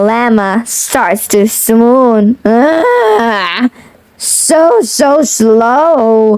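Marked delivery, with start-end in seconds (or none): none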